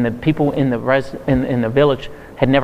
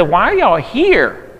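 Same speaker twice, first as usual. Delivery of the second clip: higher, drawn-out baffled question; puzzled questioning; 6 semitones higher, slower than usual. Retold or imitated. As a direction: stretch it out in mock bewilderment. Retelling his puzzled question to couple.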